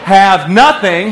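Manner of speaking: slow, loud call-out; slow on purpose. Shown as a demonstration. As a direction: stretch it for the crowd to repeat. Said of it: "I'm gonna say it slow".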